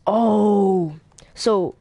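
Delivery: ordinary delivery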